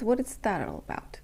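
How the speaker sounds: ordinary delivery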